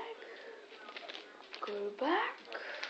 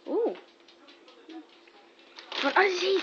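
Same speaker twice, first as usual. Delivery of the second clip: higher, louder coaxing; excited dog-talk; 6 semitones higher, 5 dB louder than usual. Real, not acted. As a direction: tempt the dog eagerly.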